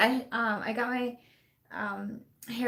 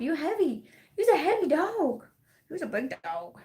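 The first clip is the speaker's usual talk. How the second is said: high pet voice; 7 semitones higher than usual. Real, not acted. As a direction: coo at the heavy dog. Pet voice to the heavy dog.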